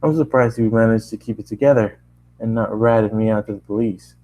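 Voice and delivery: plain reading voice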